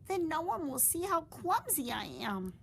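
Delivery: high, small determined voice; a little bat promising herself; high, childlike vow; young bat resolving. Acted. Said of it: "Stellaluna told herself".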